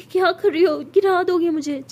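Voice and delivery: high, loud alarmed cry; girl lifted suddenly cries out, startled and loud; startled protest. A narrator's reading. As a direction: yelp as you're swept up. Sara startled being lifted.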